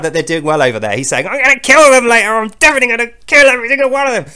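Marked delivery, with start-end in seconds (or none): 1.3-4.2 high, loud, fast threat; mock furious vow rushing out; so mad. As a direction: blurt the threat in a rage. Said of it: "He's so mad".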